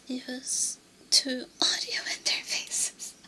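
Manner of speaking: breathy close-mic whisper. Real, not acted.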